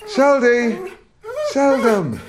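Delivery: high, loud call across the yard; calling the dog. Acted.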